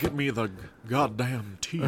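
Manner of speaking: slow, drawn-out Southern drawl; Southern accent. Acted. Mocking Cid demanding tea, slow Southern accent. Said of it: "Let's give him a Southern accent".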